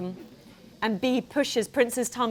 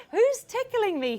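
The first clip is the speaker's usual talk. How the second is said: high giggly question; 11 semitones higher than usual. A narrator's reading. Artie being tickled.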